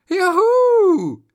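high, loud joyful cheer. Acted. Kids cheer at finding the cassette, loud and high. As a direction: squeal with delight.

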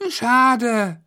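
put-on high, loud child voice; a small kid's let-down reply; disappointed child. A narrator's reading. Child answers father's refusal.

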